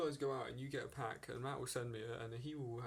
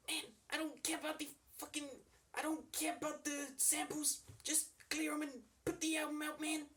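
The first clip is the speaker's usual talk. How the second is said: [name] impression; 3 dB louder than usual. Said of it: "I can do better than that".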